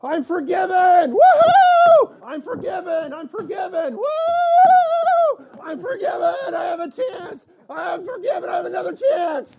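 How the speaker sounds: very high, whooping shouts of joy; Scrooge, overjoyed. Acted. Scrooge given another chance, crazily overjoyed. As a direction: go crazy with relief, squealing and whooping. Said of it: "would be just crazy and overjoyed".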